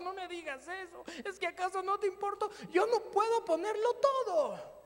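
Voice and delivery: half-comic anguished bargaining with God; desperate pleading. Retold or imitated. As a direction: plead like a cornered child. Begging God not to ask all.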